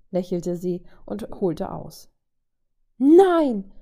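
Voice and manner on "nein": much higher, much louder cry of no; scream. Acted. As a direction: scream it to stop the blow. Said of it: "schrie Vajo".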